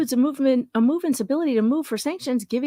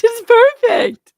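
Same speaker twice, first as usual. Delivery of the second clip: excited; 11 semitones higher, 10 dB louder, slower than usual. Real, not acted. Giddy repeat of perfect.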